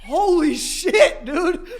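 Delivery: slow words through real laughter; laughing. Real, not acted. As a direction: laugh it out in disbelief. Laughing at the spraying champagne.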